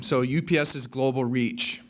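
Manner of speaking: ordinary talk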